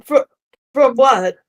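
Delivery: put-on sour old man with Russian accent; stammering Russian-accented commoner. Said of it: "sour old Russian man".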